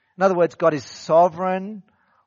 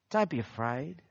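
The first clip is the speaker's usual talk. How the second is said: softer, gentle comfort; kindly reassuring; 4 semitones lower, 10 dB quieter than usual. Acted. Joseph comforting his brothers, speaking kindly and much softer. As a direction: soothe frightened brothers softly. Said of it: "spoke kindly to them".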